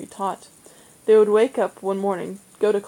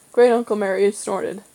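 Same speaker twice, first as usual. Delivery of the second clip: ordinary delivery, nothing marked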